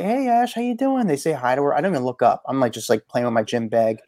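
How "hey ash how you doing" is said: high, bright mock greeting voice; lightly voiced passersby saying hi; friends greeting Ash. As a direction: play the friends calling out hello to her. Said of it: "these two people come over and say".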